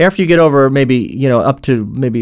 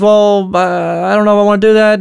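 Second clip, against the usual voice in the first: lightly voiced higher, hesitant objector; reluctant newcomer; 6 semitones higher than usual. Newcomer balking at reading the instructions aloud, mildly reluctant. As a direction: mildly balk at the request, pitched up. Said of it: "And he says".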